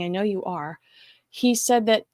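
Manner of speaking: usual speaking voice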